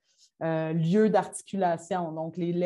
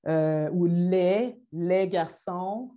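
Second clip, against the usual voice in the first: "les garçons" drawn out slowly, a touch louder; slow plural demo; 3 dB louder, much slower than usual. Shown as a demonstration. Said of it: "démonstration au tableau". (« les garçons » articulé lentement)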